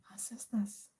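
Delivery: ordinary talk, nothing marked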